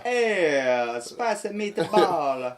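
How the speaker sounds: put-on high, slow, over-the-top Italian accent; hammed-up Italian accent. Joking about cheesy Italian accents, a hammed-up Italian voice. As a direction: ham it up like a cartoon Italian. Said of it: "try to do Italian accent".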